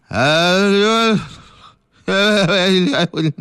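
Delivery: stuck on the sound, tongue frozen; can't get the words out for fear; mild fearful stammer. Acted. Constable He tries to question her, stuck stammering mildly. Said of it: "舌头都抽筋儿了，直哆嗦啊".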